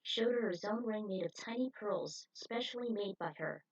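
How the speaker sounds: usual speaking voice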